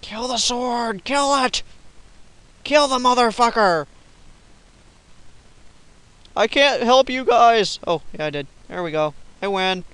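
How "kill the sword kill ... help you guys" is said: high, loud cheering on the kill; rally the team; excited shouting. Real, not acted. Gamer urging his group to kill the sword, excited high shouts.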